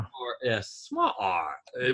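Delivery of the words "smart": "smart" high and soft, stretched to "smaat"; R-less "smart". Shown as a demonstration. Mocking how it gets said, R-less "smart". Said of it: "they don't say R's".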